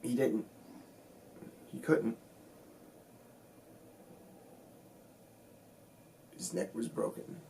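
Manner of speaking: grief-stricken recollection, broken by long pauses; pained, halting. Acted. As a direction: struggle to get the words out, dropping off at the end. Recounting friend's broken neck, long pauses.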